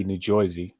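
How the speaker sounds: "Jersey" with an oy vowel, JOY-zee; "Jersey" the old East Coast way, oy for er; dialect pronunciation. Shown as a demonstration. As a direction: say "Jersey" as Joisey. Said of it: "had to force myself to say that".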